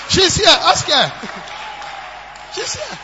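loud teasing call to the congregation; excited shout. Real, not acted. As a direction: shout it out pointing at her. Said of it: "points to wife in crowd".

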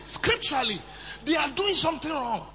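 ordinary talk, nothing marked

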